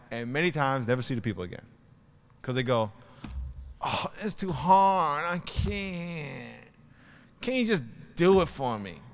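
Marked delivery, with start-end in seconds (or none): 3.8-9.0 slow, drawn-out imitation of a complainer; mock whining. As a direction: whine it higher, dragging out the words. Voices people who give up.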